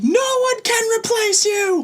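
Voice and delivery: put-on high, slow, dramatic kid's wail; screaming second grader. Teacher retelling a second grader's dramatic scream. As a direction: cry it out like a heartbroken kid. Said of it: "one of my little second graders screamed".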